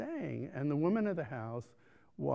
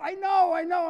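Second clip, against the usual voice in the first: exasperated; 9 dB louder than usual. Retold or imitated. Exasperated reply to yelling locals.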